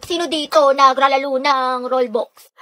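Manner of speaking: usual speaking voice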